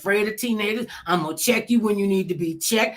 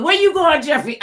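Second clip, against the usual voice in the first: loud, high-pitched parental interrogation; questioning parent; 9 semitones higher, 7 dB louder than usual. Acted. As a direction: call out like a parent demanding answers. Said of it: "could you imagine saying to your mother".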